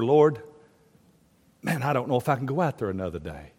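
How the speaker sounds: low, soft, quick confession; weary prayer. Acted. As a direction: sigh it out, worn down. Voices worn-down pastor praying.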